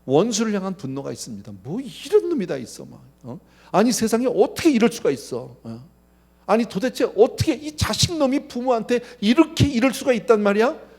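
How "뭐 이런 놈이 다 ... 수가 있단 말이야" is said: loud, high, outraged cry at a betraying son; indignant outburst. Retold or imitated. Preacher acting out a betrayed father's rage, loud and high. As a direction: voice a father's fury at his child. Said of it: "막 이러고 화가 날 수밖에 없죠".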